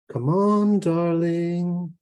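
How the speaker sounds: high, held-out singing lilt; sing-song. Acted. Strange visitor coaxing a mother to open the door, sing-song. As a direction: croon it teasingly on sustained notes. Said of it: "it sang again".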